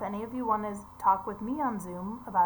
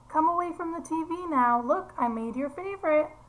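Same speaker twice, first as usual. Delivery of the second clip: higher, gently cajoling mother; coaxing mom; 7 semitones higher, 4 dB louder than usual. Acted. Teacher voicing the mother calling her son from the TV, high and coaxing. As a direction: lure the kid away kindly.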